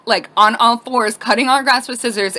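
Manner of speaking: ordinary delivery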